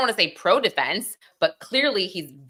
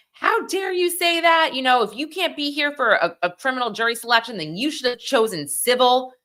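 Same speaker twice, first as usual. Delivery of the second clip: mock angry judge; 8 semitones higher than usual. Judge scolding juror for dodging jury duty, high indignant rebuke. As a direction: play the outraged judge, pitched way up. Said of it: "He freaked out at this guy".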